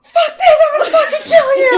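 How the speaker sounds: very high, very loud screech; screechy high-pitched voice. Retold or imitated. Boy thrown up on turns on the monster, imitated screechy shriek. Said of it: "I was in a screechy high pitched voice".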